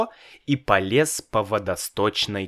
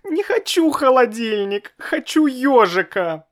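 put-on tearful child wail; 13 semitones higher, 4 dB louder than usual. A narrator's reading. Child cries refusing.

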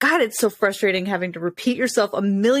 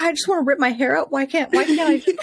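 higher, fast mock despair; frazzled venting; 6 semitones higher than usual. Friend voicing a frazzled parent's thoughts, exasperated. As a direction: play the parent at the end of their rope.

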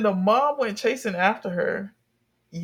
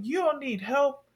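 mock scolding; 5 semitones higher, 3 dB louder than usual. Retells stepdad scolding the girl.

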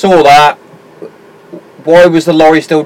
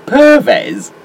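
sharply higher disbelieving repeat of "Pervez"; incredulous echo; 13 semitones higher than usual. Real, not acted. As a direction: echo the name in high disbelief. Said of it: "P E R V E Z".

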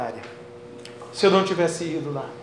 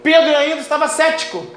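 raised, high-pitched sermon cadence; full-force preaching; 9 semitones higher, 8 dB louder than usual. Real, not acted. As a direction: proclaim it fervently to the congregation. Prega com força total. (pregação fervorosa com voz elevada)

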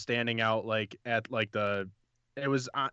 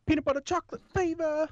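high put-on voice; 3 dB louder than usual. Rattling off cereals in put-on voice.

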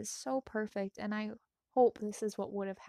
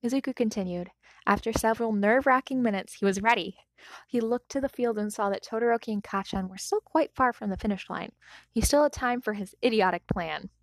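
calm storytelling read; story narration; 6 dB louder than usual. A narrator's reading. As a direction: read it like an audiobook. Reads fanfic aloud.

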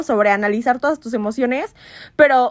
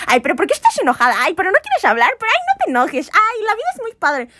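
pushy cheerful person; 6 semitones higher than usual. Retold or imitated. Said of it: "es como".

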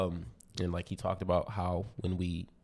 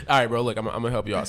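loud high laugh, still amused; loud laughter; 4 semitones higher, 11 dB louder, faster than usual. Real, not acted. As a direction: laugh big then talk. Loud laugh then playful offer.

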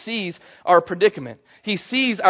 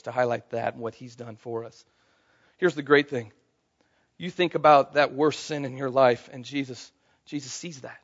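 tender quiet; 6 semitones lower, 7 dB quieter than usual. Real, not acted. Tender quiet part of sermon.

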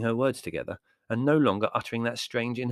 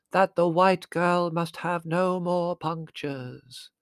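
high, very slow chant-like intoning; trance announcement; 9 semitones higher, 4 dB louder, much slower than usual. A narrator's reading. Medium relaying the spirit's orders, intoned announcement. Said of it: "announced Missus Haddock".

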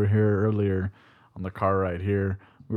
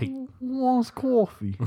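very high, slow Canadian-ish call; drawn-out Toronto barista offer; Canadian accent; 13 semitones higher, much slower than usual. Retold or imitated. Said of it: "Brooklyn to, like, Toronto".

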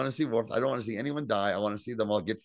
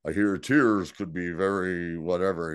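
slow, lower, solemn Klingon; lightly voiced, measured Klingon line; retold Worf; 4 semitones lower than usual. Podcaster quoting Worf comforting a crying friend, retold slow and low. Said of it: "He's like".

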